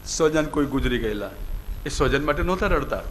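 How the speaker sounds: ordinary talk, nothing marked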